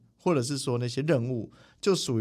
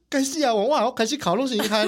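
possessed outburst; 10 semitones higher, 5 dB louder than usual. Retold or imitated. Retelling his own sudden possession.

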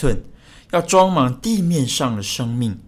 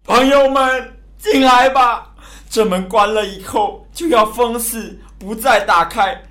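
desperate shouted plea; 3 semitones higher, 3 dB louder than usual. Acted. Noah pleads with friends before the door is sealed, loud desperate calling. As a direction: call out urgently to the crowd. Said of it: "挪亚声嘶力竭地喊着".

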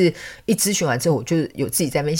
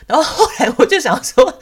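laughing; 11 semitones higher, 6 dB louder than usual. Real, not acted. Laughs at owner's reply.

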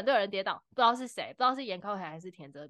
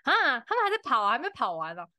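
mock bored spectator; 5 semitones higher, 4 dB louder than usual. Stadium fan grumbling at endless race, mock bored. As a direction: whine like a fan sick of waiting. Bored crowd voiced in story.

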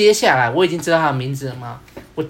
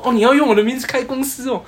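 眼睛一亮; 8 semitones higher, 4 dB louder than usual. Retold or imitated. 对手听到名字开公司.

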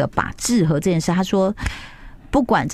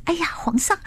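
fawning courtier; 11 semitones higher than usual. Retold or imitated. Mingzhu greets emperor, soaked, fawning.

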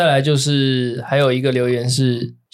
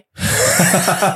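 high laugh; 5 semitones higher than usual. Real, not acted. Reacts to misread comment.

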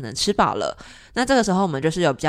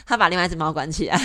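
ordinary delivery

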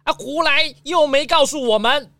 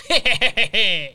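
a pleased, sly little heh-heh-heh; smug chuckle. Acted. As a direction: chuckle proudly at your own idea. Boasts about driving his own car.

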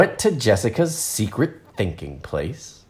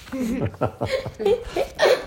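high giggle; a real laugh bursting out; laughing at the character; 7 dB quieter than usual. Real, not acted. Reader laughs.

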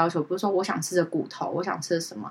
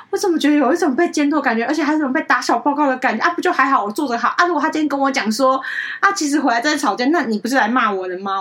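higher, louder mock-sarcastic grumble; sneering complaint; 6 semitones higher, 5 dB louder than usual. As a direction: play up the friend's cold laugh. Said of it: "他的笑是那种监督".

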